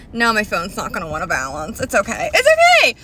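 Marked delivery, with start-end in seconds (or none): 2.3-3.0 very high, loud squeal; excited yell. Real, not acted. Vlogger shrugs off wobbling phone, excited yell.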